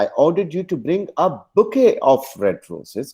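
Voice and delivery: "bouquet" lifted and stretched, slow sentence; stress on BOUQUET. Shown as a demonstration. Teacher shows a whole bunch, stress on bouquet. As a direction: punch the word "bouquet". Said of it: "पूरा bunch चाहिए था".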